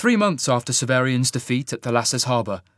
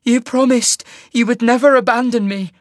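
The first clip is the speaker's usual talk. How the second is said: high, loud, hurt plea; tearful reproach; 12 semitones higher, 8 dB louder than usual. A narrator's reading. Sasha begging Cole not to abandon her, tearfully. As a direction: hold him to it through tears. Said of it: "tears in her eyes now".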